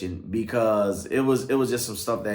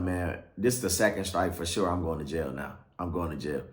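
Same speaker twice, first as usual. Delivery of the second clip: low, subdued inner monologue; thinking to himself; 5 semitones lower, 5 dB quieter than usual. Retold or imitated. Sitting cuffed on the curb, thinking to himself, subdued. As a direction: drop into quiet, resigned self-talk. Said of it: "I'm thinking to myself".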